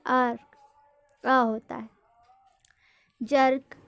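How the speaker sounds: usual speaking voice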